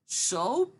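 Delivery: put-on high questioning kid voice; imitated young kid. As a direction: ask it up high, mimicking a child. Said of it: "He's like".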